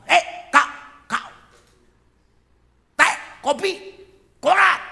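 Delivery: loud, high barked orders; demanding husband. Acted. Husband two years married orders tea and paper, shouting. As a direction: shout single-word orders with gaps between.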